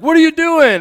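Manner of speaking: loud, high-pitched shocked question; acted-out yell of disbelief; alarmed bystander. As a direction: shout it like a startled onlooker. Startled family sees him tumble.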